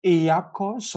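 I A Q O S letter by letter, slowly; "I A Q O S" as five separate, slow letters; spelled out. Shown as a demonstration. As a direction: spell out "I A Q O S" one letter at a time. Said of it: "different way of pronouncing it".

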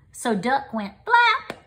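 usual speaking voice

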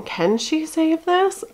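high, drawn-out question of an anxious viewer; mock suspense. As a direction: imitate viewers on tenterhooks, stretching every word. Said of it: "sitting on the edge of their seats saying".

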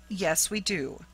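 plain reading voice

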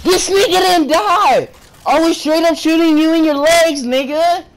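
high, outraged protest; indignant yelling. Real, not acted. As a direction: protest in disbelief at the player. Protesting that enemy didn't die.

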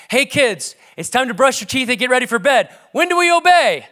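put-on rally the kids with a rising, loud question; calling dad.

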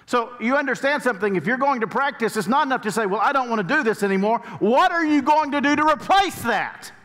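full-force preaching. Acted. Preacher urging the congregation, rising to full-force preaching. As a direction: drive the point home to the congregation.

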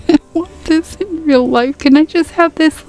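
high, delighted pleading; excited squeal. Real, not acted. Gamer squealing to viewers over a cute kit, excited. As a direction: beg for it, giddy and high.

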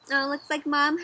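usual speaking voice

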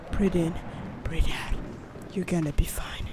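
low, breath-only murmur fading to whisper; hushed self-soothing. Real, not acted. Player calming himself after a scare, hushed whisper. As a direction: talk yourself down very quietly.

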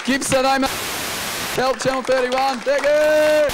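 high-pitched call to the crowd; calling out sign-off. Real, not acted. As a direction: sign off over the applause, drawn out at the end. Comic signs off to applauding audience.

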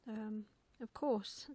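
slow, halting agreement; hesitant little owlet covering up; awkward hesitation. Acted. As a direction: play along uneasily, drawing it out. Owlet covering up his embarrassment.

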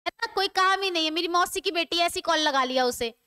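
ordinary talk, nothing marked

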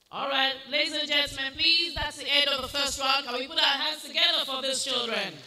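loud, high, brisk host voice; rally the crowd to clap; announcer calling for applause. Real, not acted. Host addresses crowd, calls for applause.

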